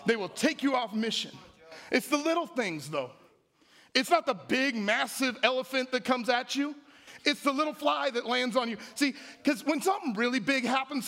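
high-pitched, insistent preacher's cadence; emphatic preaching. Real, not acted. Preacher warning the church about little distractions, insistent.

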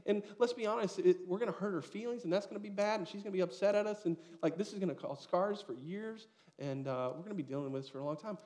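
wife in the story spiralling over hurt feelings, hammed-up and fast; fretting wife. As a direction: rattle off every worry like the anxious mom. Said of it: "My wife's like".

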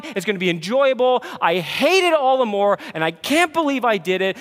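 anguished confession swelling to loud; fervent prayer, building. Retold or imitated. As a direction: pour out the frustration to God, rising. Voiced prayer of frustration.

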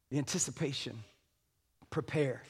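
very soft, low, near-hushed words; hushed anticipation. Real, not acted. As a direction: let it sink in, low and soft. Hush after acting the wedding gasp.

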